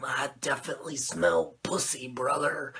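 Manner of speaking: gravelly low growl of Macho Man Randy Savage; Macho Man impression. Macho asking Sting about the Dungeon of Doom, in a growling Macho Man voice. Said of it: "Macho's like".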